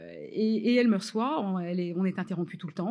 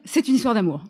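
loud triumphant announcement; emphatic retold bluff; 9 dB louder than usual. Writer finally naming her improvised idea to producer, loud and emphatic. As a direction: land it loudly as the winning reveal.

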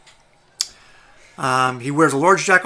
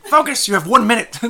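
loud, higher urging shout; urgent call; 6 semitones higher, 6 dB louder than usual. Acted. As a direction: snap them back to the task. GM voices party urging focus.

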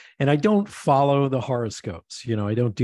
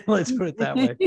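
laughing through it, higher; 8 semitones higher, 3 dB louder than usual. Real, not acted. Laughing at her own rough start as a speaker. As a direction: laugh it off at herself.

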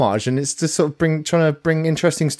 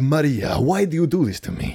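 telenovela drama. Retold or imitated. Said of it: "sounded kind of like a Mexican teleplay".

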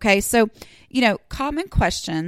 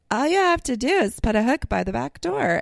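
mock know-it-all; 5 semitones higher than usual. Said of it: "they will say things like".

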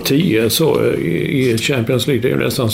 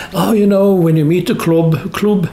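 higher English pundit voice; hammed-up English co-commentator; Phil Neville impression; 7 semitones higher than usual. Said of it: "han sa, han sa". (härmar en engelsk bisittare, högre)

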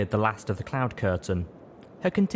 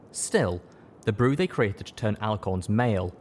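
plain reading voice

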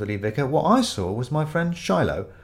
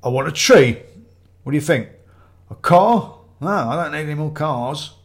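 louder, raised-pitch pushy buyer; demanding customer; 4 semitones higher, 7 dB louder than usual. Acted. Ramage tells the tree seller what he wants, pushy and demanding. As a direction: throw out blunt, loud demands.

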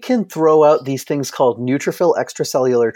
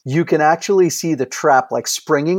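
ordinary talk, nothing marked

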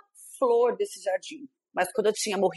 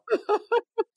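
laughter; 11 semitones higher than usual. Real, not acted. Laughing at own story.

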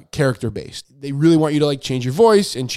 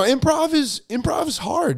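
usual speaking voice

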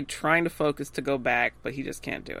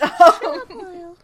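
high, loud echo of an outburst; exaggerated high-pitched scolding; mock yell; 12 semitones higher, 10 dB louder than usual. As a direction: shout it playfully, hammed up. Echoing the quoted outburst.

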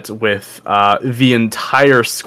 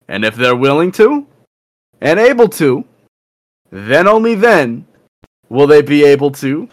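higher, proclaiming ringleader; squad captain; 5 semitones higher than usual. Acted. Captain lays out tournament rules to everyone, higher proclaiming voice. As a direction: announce the rules to a crowd, pitched up with rising phrases.

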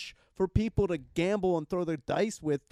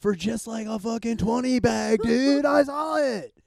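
excited shout; 7 semitones higher, 7 dB louder than usual. Real, not acted.

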